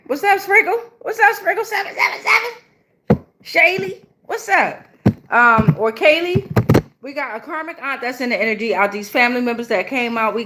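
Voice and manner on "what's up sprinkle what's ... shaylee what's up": playful high squeal of hello; high-pitched greeting. Real, not acted. Streamer hailing viewers joining the chat, high-pitched greeting.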